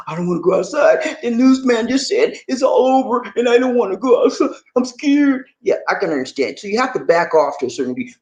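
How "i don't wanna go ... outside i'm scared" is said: raised, timid pretend fear; mock scared voice. Voicing a viewer scared by the news, lightly mock fearful. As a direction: play a frightened person lightly.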